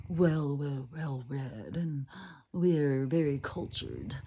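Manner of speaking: slow, lightly voiced posh snob; mock the cultured East Coaster, drawn out; a hint of a self-satisfied highbrow; snooty East Coast type. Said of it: "East Coast is very, like, up their own ass".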